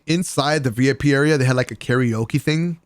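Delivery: ordinary talk, nothing marked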